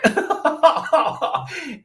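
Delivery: laughter. Real, not acted. Laughs after his own joke.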